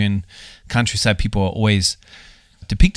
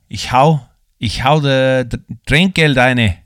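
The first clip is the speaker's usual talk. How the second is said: higher, louder rural Styrian impression, Trinkgeld drawn out slowly; mock Styrian accent; 4 semitones higher, 4 dB louder, slower than usual. Australian host imitates the waitress in Styrian dialect, higher and louder. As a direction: put on a thick Styrian countryside accent. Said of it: "she said".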